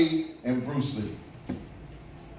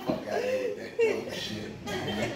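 laughing through the words, high and soft; 6 dB quieter, slower than usual. Real, not acted. Comic laughing at his own sugar daddy joke. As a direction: crack up while saying it.

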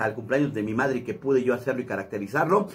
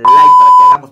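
loud plea; 19 dB louder than usual. Real, not acted. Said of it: "final appeal to viewers".

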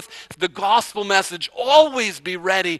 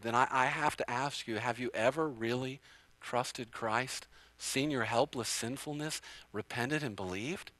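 soft earnest question; 7 semitones lower, 15 dB quieter than usual. Real, not acted. Pastor's searching personal question.

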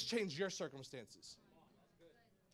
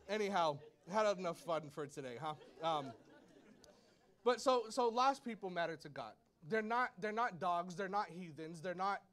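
ordinary delivery

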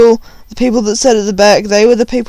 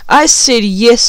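ordinary delivery

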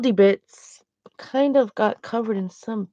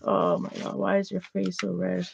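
mock whisper; 2 semitones lower, 10 dB quieter than usual.

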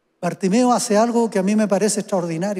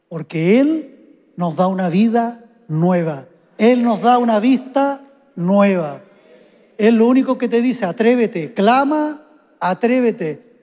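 fuerte y lento, exhortando; predicación intensa; 3 semitones higher, 6 dB louder, slower than usual. Acted. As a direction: empuja cada frase con fuerza. (predicador que anima a atreverse, con fuerza)

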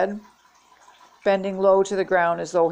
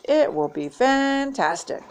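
high, stretched-out delight; dreamy excitement; 7 semitones higher than usual. A narrator's reading. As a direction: savor it slowly, pitch soaring. Jeff daydreams of fame.

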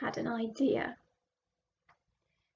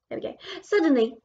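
usual speaking voice

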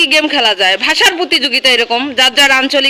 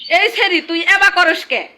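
Mymensingh dialect; 3 semitones higher than usual. Shown as a demonstration. Scolding a village girl in Mymensingh dialect. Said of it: "আমার ভাষায় হবে".

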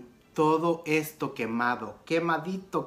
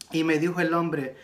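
ordinary talk, nothing marked

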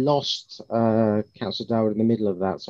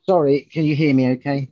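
raised, louder voice; speaking up; 4 semitones higher, 8 dB louder than usual. Real, not acted. Officer checking the chair can hear, speaking up. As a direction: lift the volume to be heard.

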